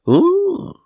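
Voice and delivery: a sudden thrilled squeak; high excited ooh. Acted. Spark of excitement.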